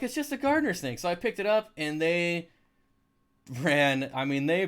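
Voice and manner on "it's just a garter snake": calling out that it's only a snake; exasperated reassurance. Retold or imitated. Hiker holding a snake calls to the screaming women, exasperated and high.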